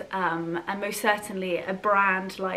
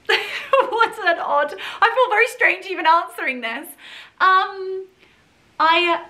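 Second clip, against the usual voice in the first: embarrassed laugh; 11 semitones higher, 8 dB louder than usual. Real, not acted. Embarrassed laughing at self-praise question.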